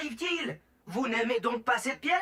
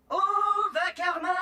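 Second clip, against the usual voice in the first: usual speaking voice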